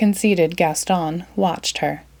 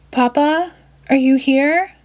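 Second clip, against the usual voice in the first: higher, louder call into the dark; 7 semitones higher, 3 dB louder than usual. A narrator's reading. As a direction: call out anxiously for your father. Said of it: "cried Belle".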